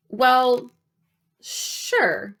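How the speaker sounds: hesitant, with a long pause between words; cautious answer. A narrator's reading. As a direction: answer warily, holding back. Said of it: "I answered cautiously".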